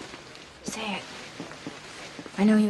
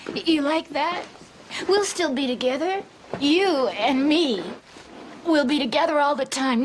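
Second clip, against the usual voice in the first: normal talking voice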